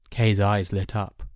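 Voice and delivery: usual speaking voice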